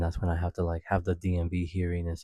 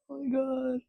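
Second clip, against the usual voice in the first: very high, breathless "oh my God"; overjoyed gasp. Retold or imitated. Told the state confirms it, a very high relieved squeak.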